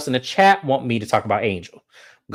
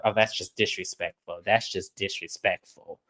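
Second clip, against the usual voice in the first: hushed disapproval; 7 semitones lower, 10 dB quieter than usual. Retold or imitated. Giselle murmuring low disapproval at the scene. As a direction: murmur it like a scandalised onlooker. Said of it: "And Giselle's like".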